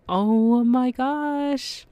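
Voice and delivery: long drawn-out, high, loud "oh my gosh"; blown away. Retold or imitated. Podcaster acting out amazement at her award nomination, blown away. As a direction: stretch it out in a high, overwhelmed cry. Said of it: "I'm just like".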